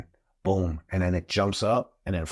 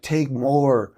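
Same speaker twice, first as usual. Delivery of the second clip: urging call; 7 semitones higher, 6 dB louder than usual. Retold or imitated. Trey urging over the phone.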